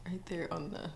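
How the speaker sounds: whispered laugh. Real, not acted. Laughing at the elf's spot.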